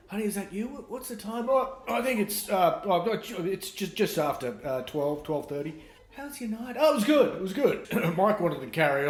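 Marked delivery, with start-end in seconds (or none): 0.1-1.5 sleepy partner. Retold or imitated. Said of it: "She says". 1.5-7.9 funny voice. Retold or imitated. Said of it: "Why are you talking in that funny voice?".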